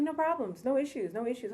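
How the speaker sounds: high, soft mimic of the mom; mimicking the mom brushing off the question, high and soft; mock denial. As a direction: imitate her breezy no issues.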